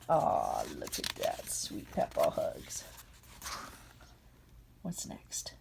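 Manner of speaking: soft unvoiced whisper. Real, not acted. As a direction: whisper it like a secret. Aside over tender picture.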